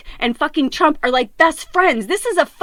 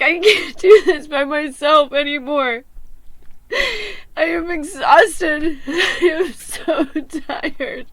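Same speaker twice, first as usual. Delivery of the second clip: slow, breaking, laughing through tears at the end; exhausted crying; slower than usual. Real, not acted. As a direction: let the tiredness crack the voice.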